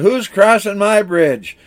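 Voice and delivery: high-pitched, hammed-up troll demand; mock troll challenge. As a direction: pitch it up as an indignant storybook troll. Troll challenges the big goat.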